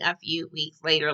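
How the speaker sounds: half-hearted cartoon narrator; SpongeBob narrator. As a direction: announce the time skip like a cartoon card. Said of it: "remind me of that SpongeBob little thing".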